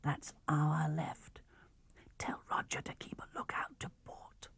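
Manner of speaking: hushed orders in the dark; John whispering orders to Susan. Acted. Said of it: "whispered John".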